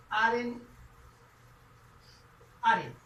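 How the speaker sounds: "aren't" said twice, drawn out, as a model; pronunciation demo. Shown as a demonstration. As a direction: model the contraction "aren't" clearly. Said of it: "yo digo".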